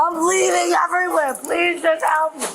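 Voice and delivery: crying, begging for help; desperate crying plea. Real, not acted. Hurt, cuffed, begging for help.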